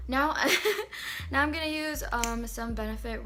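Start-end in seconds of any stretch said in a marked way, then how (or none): 0.4-1.3 short high, loud giggle; laugh. Real, not acted. Vlogger amused while restarting her sentence, laughing. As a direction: break into a quick real laugh.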